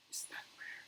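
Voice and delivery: hushed anxious whisper. Acted. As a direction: breathe the question, no voice. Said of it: "whispered Professor McGonagall".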